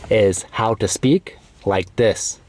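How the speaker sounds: "is how to speak like this" said slowly and clearly. Shown as a demonstration. Teacher shows learners his clear, slow speaking voice. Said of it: "clear, slow".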